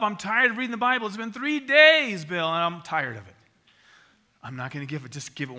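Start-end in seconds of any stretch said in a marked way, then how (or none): 0.0-3.3 much higher and louder, a hammed-up moaning gripe; whiny complainer. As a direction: play the fed-up Bible reader throwing a fit. Mock complaint of weary churchgoer.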